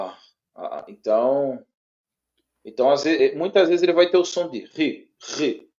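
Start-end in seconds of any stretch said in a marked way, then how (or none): 4.7-5.7 RI with a rasped R in the throat; throaty R. Shown as a demonstration. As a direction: say "ri" with the R made in the throat. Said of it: "de R na garganta".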